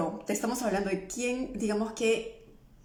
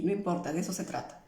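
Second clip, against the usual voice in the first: ordinary delivery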